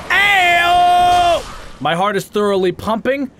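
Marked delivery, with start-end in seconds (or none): 0.1-1.4 loud, high drawn-out whoop; hyped-up shout. Real, not acted. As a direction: holler it high to pump yourself up. Hype cry before boss fight.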